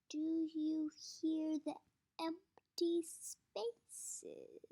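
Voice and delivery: slow menace. Acted. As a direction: let each word hang.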